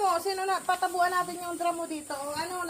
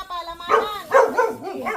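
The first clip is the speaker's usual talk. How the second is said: louder, naming the item for a hard-of-hearing father; loud announcing; 10 dB louder, slower than usual. Real, not acted. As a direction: call out the food's name clearly. Showing groceries to elderly father. (malakas na pagsasabi)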